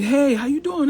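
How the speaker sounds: eager guy. Retold or imitated. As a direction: play a guy who just jumped off the train to say hi. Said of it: "they'll jump off the train".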